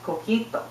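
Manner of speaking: "coquito" in crisp Spanish pronunciation; relative's correction. Acted. Relative correcting the speaker, "coquito" pronounced the Spanish way. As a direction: say "coquito" with pure Spanish vowels. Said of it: "No, it's pronounced".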